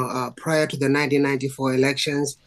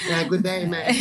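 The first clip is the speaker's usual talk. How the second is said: playful Aussie greeting, pitched up; mock Australian accent; 4 semitones higher than usual. Guest joking back to the host, mock Australian accent. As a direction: play along with a jokey Australian accent. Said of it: "got an Australian accent yet".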